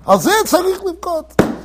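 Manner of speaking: slow, high retold lament; imitated tearful cry. Retelling the old rabbi as he starts crying, imitated plaintive lament. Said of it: "He starts crying".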